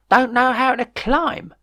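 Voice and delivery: high, slow astonished disbelief. A narrator's reading. Jeff stunned the yobs can't climb, high and slow. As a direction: let it soar up in disbelief. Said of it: "he said in astonishment".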